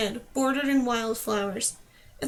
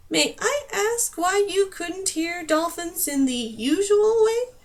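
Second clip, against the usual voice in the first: slow, higher, sweetly menacing question; dangerously pleasant; 6 semitones higher, slower than usual. Acted. Numer questioning Dane with dangerous pleasantness. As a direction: ask politely with menace underneath. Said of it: "Numer's voice was dangerously pleasant".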